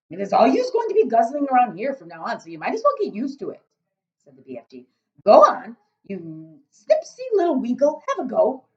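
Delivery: loud, booming friendly giant; a big-hearted giant rumbling away. Acted. BFG speaking.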